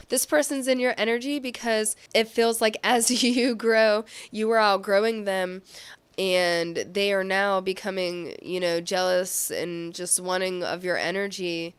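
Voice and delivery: normal talking voice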